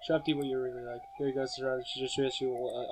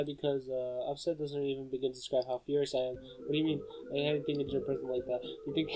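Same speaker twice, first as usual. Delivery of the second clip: normal talking voice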